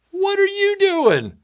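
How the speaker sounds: high, drawn-out, loud call; mock heckler's holler. Guy yelling from the grass, high mock holler.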